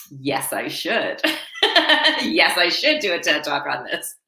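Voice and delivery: reply through a laugh, drawing out the first words; amused laughing reply. Retold or imitated. Author answering her sister's TED Talk suggestion, laughing. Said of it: "And started laughing".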